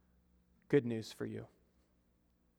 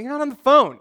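higher, louder protest; incredulous; 9 semitones higher, 6 dB louder than usual. Retold or imitated. Preacher calling out his brother's fake call, raised. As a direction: call his bluff out loud. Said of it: "And I'm like".

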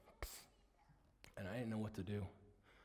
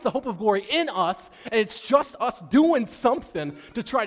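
loud, high, driving sermon shout; fiery preaching; 7 semitones higher, 10 dB louder than usual. Acted. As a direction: preach it hard at full force. Preaching rises again.

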